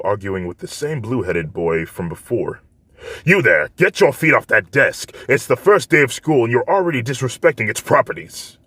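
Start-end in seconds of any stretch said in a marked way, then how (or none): 3.2-8.6 raised, higher scolding hall monitor; stern Ida voice. Acted. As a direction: lecture him loudly like a strict class rep.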